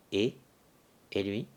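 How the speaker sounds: ordinary delivery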